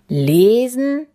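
loud, rising one-word question; puzzled surprise. A narrator's reading. Space king baffled by Bella reading, puzzled surprise. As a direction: echo it, sliding up in astonishment. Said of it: "wunderte sich Masimotos".

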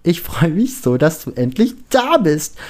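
high, gushing excitement; overjoyed greeting. A narrator's reading. Penelope hugging Lukas at last, overjoyed gushing. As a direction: squeal with delight as you hug him.

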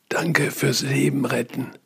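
thanks pushed out through a sore throat; strained thin voice. Real, not acted. Patient thanking the doctor, thin strained voice. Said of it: "Sprechen geht mit Mickey-Maus-Stimme".

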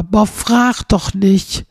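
loud, slow, exasperated groan of a thought; silent plea. Retold or imitated. Customer at the bakery, thinking pleadingly: frag doch nicht. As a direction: drag it out, begging the customer to stop.

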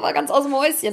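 usual speaking voice

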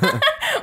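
sudden high laugh; high burst of laughter. Real, not acted. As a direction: laugh out loud. Laughs about bitten tongue.

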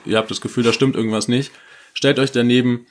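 usual speaking voice

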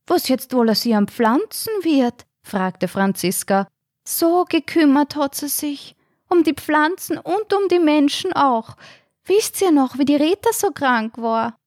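put-on old woman voice. Grieving resident remembers Rosi.

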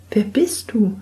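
put-on high girlish voice, stammering in alarm; she's caught out and bristles back; young girl, flustered and defiant; startled young girl. Girl asks his name.

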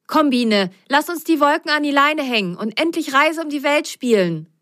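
high, pushing urgency; impatient. Acted. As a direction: hurry her along. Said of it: "sagte Ben nun ungeduldig".

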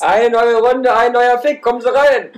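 loud, high fairground barker; market crier. Pretend. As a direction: call the crowd in, full voice. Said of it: "Und du bist der Marktschreier".

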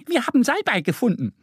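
very high, fast duck voice greeting; excited duckling. A narrator's reading. Duck Annie greets the pharmacist, very high fast. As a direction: flutter up eagerly and say hello.

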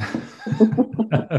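high-pitched real laugh. Real, not acted. Host amused by speaker wanting muting, laughing.

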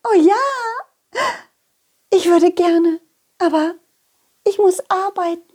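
high, dreamy lady donkey, a bit dizzy; dazed lady donkey. Acted. Lady donkey after bumping heads with the donkey, dazed and high. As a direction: she just bumped heads and shakes it off.

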